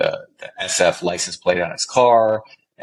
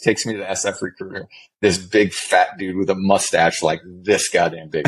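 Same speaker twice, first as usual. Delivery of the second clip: ordinary delivery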